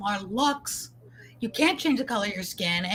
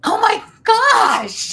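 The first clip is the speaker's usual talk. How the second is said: high, loud, drawn-out gasp of shock; slow and loud, a real cry of disbelief; real shocked exclamation, much higher and slower; stunned cry; 11 semitones higher, 10 dB louder, much slower than usual. Real, not acted. Host hears the Tyler Perry reveal.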